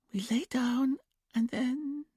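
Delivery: high, slow, whispered dreamy voice; trance whisper. A narrator's reading. Julia in trance answering Dominic, high childlike whisper. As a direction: breathe it out far away, like a child. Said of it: "Julia trailed off".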